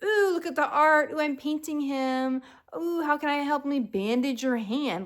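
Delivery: mock a girl who's smitten; sing-song mocking of a lovesick girl; mocking dreamy swoon. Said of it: "you're just like".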